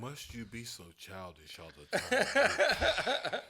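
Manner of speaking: slow, laughing haughty dragon. Pretend. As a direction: drawl it like a lofty dragon. Mock villain banter.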